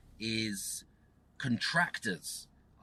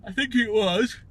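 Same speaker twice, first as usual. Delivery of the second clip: words stretched by a real yawn; 8 semitones higher, 9 dB louder than usual. Real, not acted. Host yawning mid-sentence while quoting his offer, real yawn. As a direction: talk through a yawn, higher and louder. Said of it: "another yawn".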